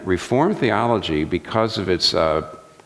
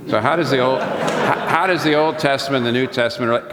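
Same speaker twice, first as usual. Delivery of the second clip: high, louder mock question; mock questioner; 8 semitones higher, 3 dB louder than usual. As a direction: play the eager asker, pitched up. Mock questioner to Reformed person.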